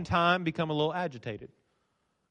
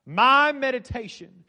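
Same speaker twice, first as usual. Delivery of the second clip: "My" drawn out slowly and pitched up; stress on My; 4 semitones higher, slower than usual. A narrator's reading. Preacher showing the psalm is personal, stress on "My". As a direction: lean long and high on "My" in "My meditation". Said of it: "My, my, and my".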